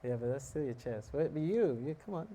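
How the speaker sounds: ordinary delivery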